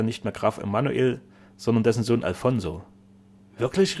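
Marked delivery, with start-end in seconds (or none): 3.6-4.0 high, startled question; dismayed. A narrator's reading. Duke learns of the count's successor, dismayed. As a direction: jump up in pitch, taken aback. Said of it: "rief der Herzog bestürzt".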